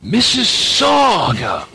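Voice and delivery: drawn-out, high-pitched imitated shout; very loud call. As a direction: bellow the town name slowly, mimicking him. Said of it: "he just says very loudly".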